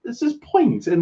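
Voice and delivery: high-pitched defensive reply; high protest. Real, not acted. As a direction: jump up high to object. Protesting partner's teasing.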